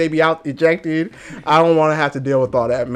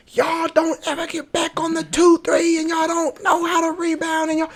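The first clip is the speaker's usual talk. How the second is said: high, strained courtside yell; yelling coach; 12 semitones higher than usual. Retold or imitated. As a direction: bark it at the girls like an angry coach. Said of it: "the coach was yelling at the players".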